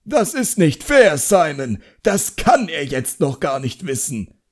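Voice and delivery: high, loud indignant outburst; a flustered master crying foul; upset protest. A narrator's reading. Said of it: "Arthur Underwood protestierte aufgebracht".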